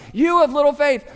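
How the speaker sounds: loud, high call over the wind; yelled rebuke. Retold or imitated. Jesus shouts at disciples, grinning at their panic. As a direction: shout it with a grin. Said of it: "He's having to yell".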